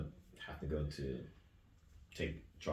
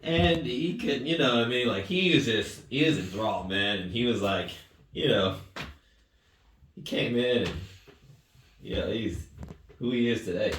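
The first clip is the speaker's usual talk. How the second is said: laughing, louder and higher, moved; choked-up laughter; emotional laughing; 5 semitones higher, 7 dB louder than usual. Real, not acted. Emotional recollection of student's return.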